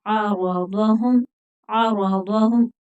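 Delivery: आरादहुम slowly, heavy r, ain from the throat; आरादहुम at a careful teaching pace; model pronunciation. Shown as a demonstration. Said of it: "आ नहीं पढ़ेंगे".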